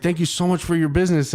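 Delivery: high, quick, beaming driver voice; imitating the driver. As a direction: rattle it off like a delighted driver. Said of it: "He's like, he's like".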